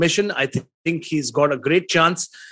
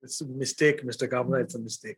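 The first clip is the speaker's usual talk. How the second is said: quiet teasing; 5 semitones lower, 10 dB quieter than usual. Acted. Teasing co-host about his pick.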